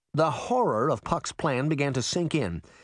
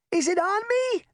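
very high, squealing question; panicked cry. A narrator's reading. Little sister checks herself after the splat, crying out. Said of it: "Daphne cried".

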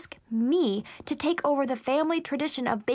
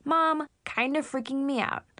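ordinary delivery

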